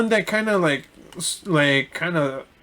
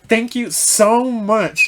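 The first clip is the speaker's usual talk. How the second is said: slow, higher, gushing thank you; perky thanks; 5 semitones higher, 4 dB louder, much slower than usual. Retold or imitated. Perky customer gushing thanks at the driver, slow and high. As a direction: stretch out the thanks sweetly.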